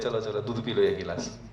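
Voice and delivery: a father's soft make-up call to his son; coaxing parent. Retold or imitated. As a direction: coax him gently after the scolding. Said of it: "फिर थोड़ा बोलते हैं".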